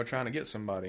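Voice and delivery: normal talking voice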